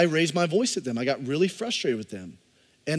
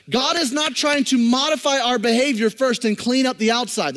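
loud, high, impassioned sermon voice; fervent pulpit delivery; full-force preaching; 9 semitones higher, 7 dB louder than usual. Acted. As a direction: preach it out to the whole room.

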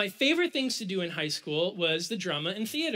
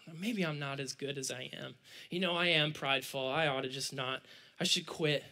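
low, soft, dejected self-talk; ashamed self-doubt; 7 semitones lower, 6 dB quieter than usual. Retold or imitated. Teenager talking himself into quitting, quietly ashamed. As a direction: act out sinking into shame. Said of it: "I started to be shameful".

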